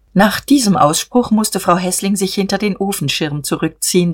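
ordinary delivery

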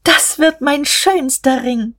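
high, drawn-out cry of joy; jubilant. A narrator's reading. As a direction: exult in it, pitch soaring. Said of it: "Da jubelte Guste".

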